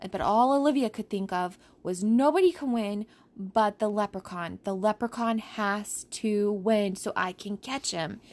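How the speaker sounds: plain reading voice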